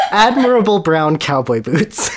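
ordinary talk

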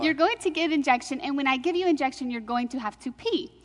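imitating an Indian nurse's instructions; Indian accent. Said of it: "she, with an Indian accent".

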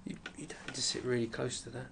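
whisper. Real, not acted. Teasing co-host, whispered aside.

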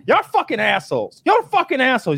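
hammed-up angry woman; mock cursing. Guest imitating the woman cursing at the cops, high and loud.

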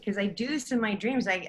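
ordinary talk

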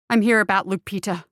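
blurted. A narrator's reading. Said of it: "he blurted at once".

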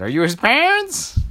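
put-on high, questioning old lady voice. Old lady quizzing the princesses, high and nosy. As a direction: peer down at them suspiciously. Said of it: "the old lady said".

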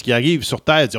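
ordinary delivery

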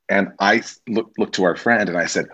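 ordinary delivery

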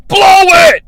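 loud, high shouted command; mock-heroic high shout; yell. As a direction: scream the order at the last second. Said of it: "he yells".

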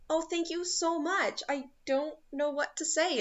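high, sugary thanks hiding disgust; mock polite thank-you, lightly voiced; fake gratitude. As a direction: gush thanks you don't mean. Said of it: "looks in disgust".

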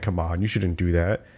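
low, soft mild scolding; mock gentle. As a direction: imitate a parent chiding gently, low and soft. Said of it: "like, a, a gentle".

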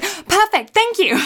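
high, loud, instantly pleased; mock triumphant cheer. Girl getting her way after fake crying, mock triumph. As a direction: chirp it loudly, as if you just won.

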